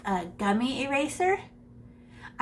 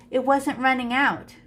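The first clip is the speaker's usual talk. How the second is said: ordinary delivery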